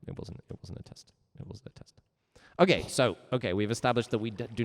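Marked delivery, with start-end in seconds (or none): 0.1-1.9 sheepish whisper; whispered confession. Real, not acted. Teacher whispering to chat that it was a lie. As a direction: whisper the admission, almost to yourself.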